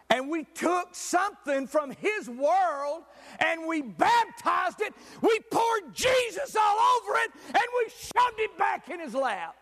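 preaching. Acted. Preacher driving home his point, building to a shout. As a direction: build to a fiery climax.

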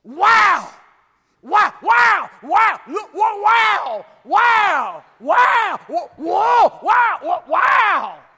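loud, high, repeated astonished shouts; amazed shouting. Pretend. Saved souls first seeing heaven, ecstatic shouting. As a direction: shout wow like you can't believe your eyes. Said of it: "just going".

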